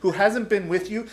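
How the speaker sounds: loud wounded protest of an insulted man; indignant outburst. Retold or imitated. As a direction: Peter bristling at Jesus, offended. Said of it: "This is what Peter's saying".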